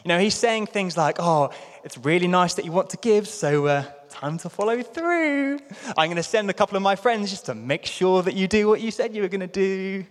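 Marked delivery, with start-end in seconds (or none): 1.2-10.0 high, sweetly pointed passive-aggressive tone; nicely veiled threat; passive-aggressive British. Retold or imitated. Paul to the Corinthians, sugary passive-aggressive British nudge. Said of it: "passive-aggressive when you read them in a British accent".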